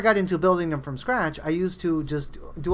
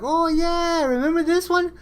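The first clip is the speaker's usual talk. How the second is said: gleeful higher voice; excited recall; 10 semitones higher than usual. Retold or imitated. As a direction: pitch it way up like finding a forgotten treasure. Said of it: "be like".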